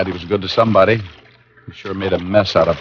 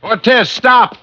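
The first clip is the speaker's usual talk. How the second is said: shouting after him; much slower than usual. Acted. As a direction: bellow it after a fleeing man. Chasing fleeing Ortiz on the beach.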